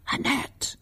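faint whispered name; whispering. A narrator's reading. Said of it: "whispered her mother".

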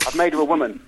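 ordinary talk, nothing marked

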